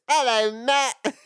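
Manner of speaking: high, nerdy squeaky hello; Urkel voice. Retold or imitated. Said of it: "ridiculous impression of how I sound".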